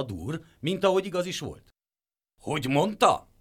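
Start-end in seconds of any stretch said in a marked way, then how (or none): 2.4-3.3 loud puzzled question; startled pig asks; taken aback. A narrator's reading. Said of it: "hökkent meg".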